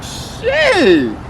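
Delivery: very high, drawn-out exclamation; retold shock. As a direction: let it squeal upward in mock shock.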